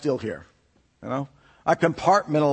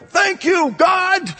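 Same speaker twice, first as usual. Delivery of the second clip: retold high, loud cry of thanks; relieved shout to God; 13 semitones higher, 4 dB louder, much slower than usual. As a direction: shout the thanks as the wheel catches. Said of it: "you yelled in my ear".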